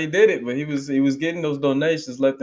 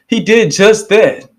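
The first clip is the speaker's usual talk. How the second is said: higher, louder and slow, each word punched; emphatic shout; 9 semitones higher, 9 dB louder, slower than usual. Real, not acted. Praising the minister to listeners, slow loud shout. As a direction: slams the repeat home like a preacher.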